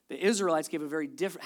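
usual speaking voice

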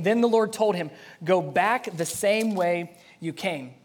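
dramatic scripture reading. A narrator's reading. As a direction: read the Lord's command out forcefully.

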